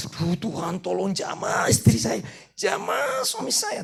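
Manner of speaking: high, slow, hammed-up pleading prayer; mock whiny prayer. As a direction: parody a spouse moaning to God. Mocking a complaining prayer.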